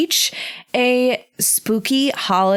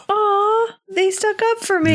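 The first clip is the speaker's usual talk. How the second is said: high, squeaky aww of gratitude; a tiny creature touched that someone stood up for it; high squeaky voice, moved and grateful; touched little creature; 13 semitones higher than usual. Acted. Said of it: "Rat Snail is like".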